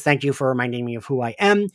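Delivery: ordinary talk, nothing marked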